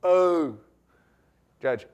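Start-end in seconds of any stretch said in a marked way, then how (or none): 0.0-0.6 loud slurred "Oh"; imitating Kerry. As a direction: mimic his hard-to-understand cry. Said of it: "it is the way he talked".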